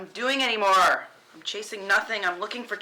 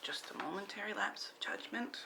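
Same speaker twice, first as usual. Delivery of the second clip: whispered aside; 13 dB quieter than usual. Acted. Muttered aside, unvoiced.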